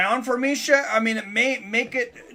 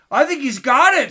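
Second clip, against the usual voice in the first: ordinary delivery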